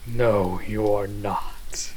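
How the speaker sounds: loud, drawn-out contradiction; firm refusal. Acted. Vampire refusing companion's offered blood, loud and emphatic. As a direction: refuse forcefully, stretching the words.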